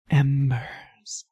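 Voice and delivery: usual speaking voice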